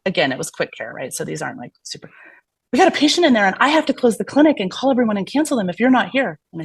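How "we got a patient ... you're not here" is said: higher and fast, pleading rush; mock panic. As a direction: imitate the frantic assistant begging her to stay. Retold panicked assistant in parking lot.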